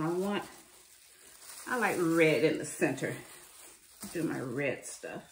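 ordinary talk

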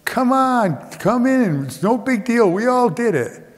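high-pitched, eager poolside calling; yelling encouragement. Retold or imitated. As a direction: call out high, coaxing them in. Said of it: "they're all yelling".

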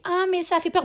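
high-pitched mock fright; mild mock squeal; imitating scared cry. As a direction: mimic a whiny horror-film fan. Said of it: "elle recommence à crier".

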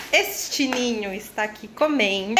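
ordinary talk, nothing marked

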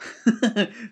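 a short embarrassed laugh at his own stumble. Real, not acted. Blogger laughs after tripping over a word, embarrassed chuckle. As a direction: laugh off the flubbed word, self-conscious. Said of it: "I have trouble saying that".

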